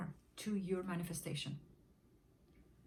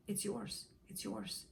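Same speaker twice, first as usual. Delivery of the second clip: softer and softer reassurance; 5 dB quieter, slower than usual. Real, not acted. Coach assuring the listener, fading softer. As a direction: tell them gently, letting it fade. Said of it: "affirming to listener".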